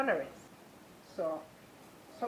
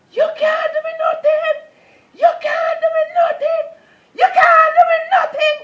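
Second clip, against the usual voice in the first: screamed far higher and louder, voice cracking; defiant crying shout; 18 dB louder than usual. Retold or imitated. Facing the man who planned to kill her, defiant screaming cry. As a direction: hurl it at him with all your force. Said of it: "the force of the voice come back to me, and I said".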